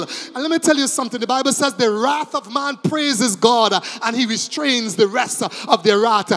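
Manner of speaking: loud, driving sermon cadence; sustained loud preaching; fervent preaching. Real, not acted. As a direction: keep pressing the congregation hard. Preaching at full force.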